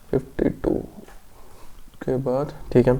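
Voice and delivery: soft whisper to yourself; whispered aside. Real, not acted. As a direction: whisper the number under your breath. Muttering the question number aside.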